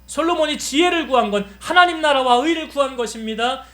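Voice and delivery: fervent prayer. Acted. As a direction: pray with mounting intensity over the congregation. Closing prayer.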